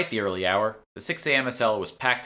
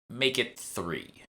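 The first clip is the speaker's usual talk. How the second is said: slow, soft interjection; 3 semitones higher, 4 dB quieter, much slower than usual. Acted. Stranger cuts in from behind.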